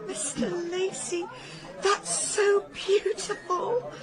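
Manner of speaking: high, slow, moved gush; gushing, tearful admiration. Acted. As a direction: coo it slowly, high and choked up. Gushes over husband's speech.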